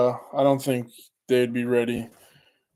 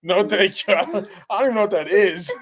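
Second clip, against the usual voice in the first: laughing; 6 semitones higher than usual. Retold or imitated. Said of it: "I says".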